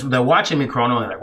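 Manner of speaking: ordinary delivery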